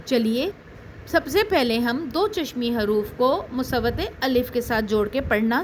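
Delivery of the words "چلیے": ordinary talk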